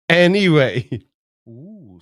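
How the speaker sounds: ordinary delivery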